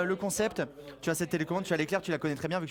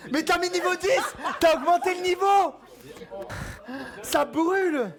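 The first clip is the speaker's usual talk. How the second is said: loud, high protest through the sting; pained protest; 10 semitones higher, 5 dB louder than usual. Real, not acted. Streamer tased at level ten, high loud protest. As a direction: complain as it burns.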